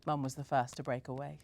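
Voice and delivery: plain reading voice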